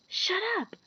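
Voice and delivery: whispered. A narrator's reading. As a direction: hush them urgently. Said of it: "Harry whispered".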